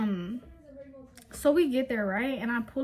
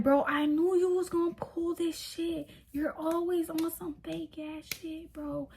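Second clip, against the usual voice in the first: mock accusing boyfriend; 6 semitones higher than usual. Boyfriend accusing her of pulling fake stuff, mock whiny. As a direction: throw the blame back in a put-on higher voice. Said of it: "he was like".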